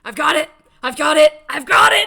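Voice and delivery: loud eureka cry; excited shout. Acted. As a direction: burst out with triumph. GM old man sudden idea.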